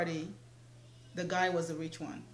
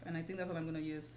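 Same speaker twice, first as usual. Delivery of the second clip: ordinary talk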